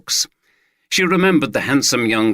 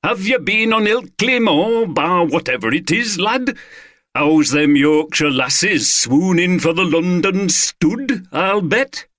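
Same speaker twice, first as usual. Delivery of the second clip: higher, hammed-up mock Yorkshire accent, drawn-out; clumsy Yorkshire parody; 8 semitones higher than usual. Friends mocking Carter as a northerner in a bad Yorkshire accent. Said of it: "delivered in clumsy parodies of a Yorkshire accent".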